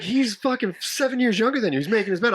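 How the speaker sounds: high, nagging imitation of his girlfriend; mock girlfriend scolding. Imitating girlfriend scolding him about the bed, high and mocking. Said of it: "She's like".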